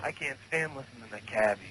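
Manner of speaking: lightly voiced Cabby, fast and soft; a hint of Cabby's voice; Cabby impression. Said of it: "That's [name] doing Cabby".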